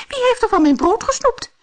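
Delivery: very high, loud and quick; fast, high-pitched outcry; indignant dwarf cry. A narrator's reading. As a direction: squeal the accusation as a tiny dwarf. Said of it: "riep de derde".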